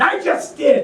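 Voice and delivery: high, laughing exclamation; laughing retort. Retold or imitated. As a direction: toss it out with a laugh.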